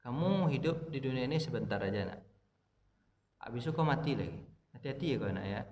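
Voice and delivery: low, soft fatherly counsel; heartfelt advice to child. Shown as a demonstration. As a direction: speak quietly into a child's heart. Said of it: "itu masuknya begitu caranya".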